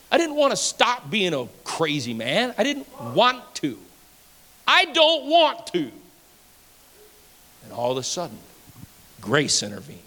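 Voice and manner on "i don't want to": high, slow defiant whine; mock stubborn sinner; stubborn refusal. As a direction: dig in like a rebel. Old self refusing church.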